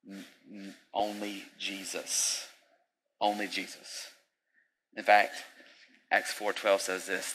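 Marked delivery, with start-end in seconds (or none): none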